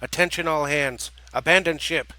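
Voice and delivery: loud commanding call; loud authoritative command. Acted. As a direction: order the crew out with authority. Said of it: "spoke with volume and authority".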